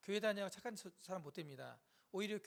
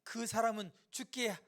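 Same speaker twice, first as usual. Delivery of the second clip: loud, slow reproach; resentful grumbling; 4 semitones higher, 7 dB louder than usual. Acted. Bystanders doubting Jesus's healing power, resentful. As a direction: accuse him in drawn-out phrases. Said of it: "원망하는 거죠".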